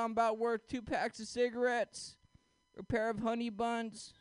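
ordinary talk, nothing marked

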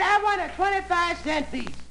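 high put-on woman's voice; lady's voice. Lady telling the ice man her order, high. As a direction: play the fussy upstairs lady.

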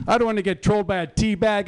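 usual speaking voice

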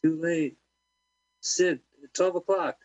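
mock warning call. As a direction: call it out like a lookout.

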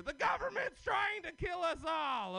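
loud, high conspiracy rant; shouting. Acted. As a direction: yell it like a paranoid crank.

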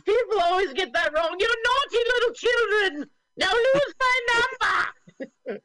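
put-on high, loud old-lady scolding; laughing lady voice, high; man doing a woman's voice. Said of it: "and she goes".

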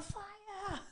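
high strained cry of fire; mock yell. Yelling fire at the intruder, high strained mock cry. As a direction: squeal the word like real panic. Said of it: "I'm yelling".

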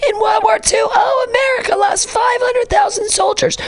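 over-the-top mock American lament; mocking whiny Americans. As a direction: shout it like a self-pitying American, hammed-up. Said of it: "Because we're like".

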